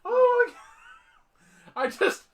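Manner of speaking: high, loud, drawn-out laughing cry; laughing hard. Real, not acted. Host cracks up over can of beans, laughing hard. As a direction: gasp it out through real laughter.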